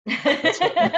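high laughing repeat; amused high-pitched disclaimer. Real, not acted. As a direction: laugh through the denial. Laughing repeat about the music.

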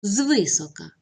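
Звисока with the stress up front on ЗВИ; ZVY-so-ka, clear model of Звисока; stress on first syllable. Shown as a demonstration. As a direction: hit the first syllable of Звисока, let the rest fall away. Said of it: "наголос на першому складі".

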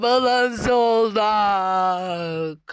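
drawn-out triumph. Real, not acted. Slow drawn-out victory.

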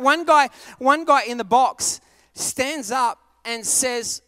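ordinary talk, nothing marked

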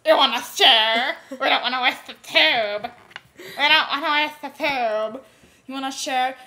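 hammed-up pushy stranger calling out; creepy stranger.

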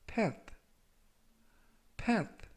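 path said as "peth"; pronunciation demo. Shown as a demonstration. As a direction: model path for learners to repeat. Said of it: "path라고 발음하시면 되겠습니다". (발음 시범)

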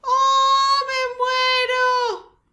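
high, loud, drawn-out swoon; swooning cry. Real, not acted. Gamer swooning over the Sims kissing. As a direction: sing out a swoon.

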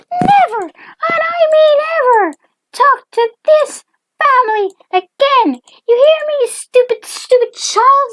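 very high, loud shrieking tirade; hammed-up, high-pitched raging; mom in a rage. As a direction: screech it like a furious cartoon mom. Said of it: "The mom, in a rage".

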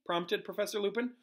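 ordinary delivery

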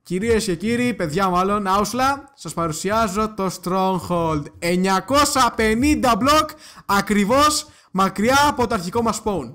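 showman announcement. Pretend. Player presents the Stronghold to viewers, showman announcement. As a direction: announce it grandly, drawing out key words.

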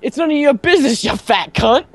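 high, quick mock-Scottish retort; Scottish accent. Player repeating Brian's line for friends who missed it, Scottish accent. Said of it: "In, like, a Scottish accent".

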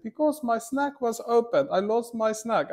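put-on a light, high little-girl voice; mock little sister. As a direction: play the small sister wailing over her snack. Quoting crying little sister.

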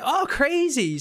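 high-pitched mock small-talk enthusiasm; polite amazement. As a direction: act politely impressed. Retelling own polite small-talk reaction.

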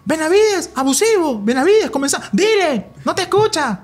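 high, excited imitation of a fellow commentator; Ramón's commentary voice. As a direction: mimic the colleague urging the player on. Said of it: "eso hacía Ramón".

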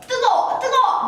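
high yelps, laughing; hot hot. Acted. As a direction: yelp as if the grease burned you. Acting burnt by oil. (기름에 데어 웃으며 높게 외치는 "뜨거워")

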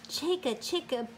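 ordinary delivery

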